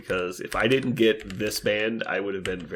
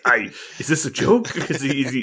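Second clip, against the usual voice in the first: mock nervous confusion; 6 semitones higher, 7 dB louder than usual. Acted confusion over smiley threat.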